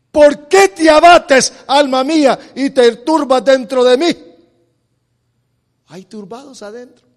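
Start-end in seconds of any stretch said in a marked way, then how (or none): 0.1-4.2 loud, ringing sermon delivery; fiery preaching. Acted. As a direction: preach it out to the congregation. Preacher at full force.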